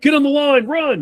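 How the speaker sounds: high, loud barked order; mock coach command. Once-friendly coach suddenly ordering players to run, high mock yell. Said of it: "super friendly and shit".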